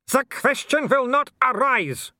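put-on high, loud, slow accented bellow. Werewolf cuts off talk of money, bellowing. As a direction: thunder it out as an irate villain. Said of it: "Wolf bellowed".

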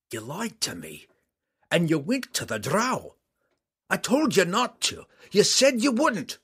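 a wounded father whose voice climbs into a scolding; hurt dwarf father. Acted. Dwarf father rebukes daughter.

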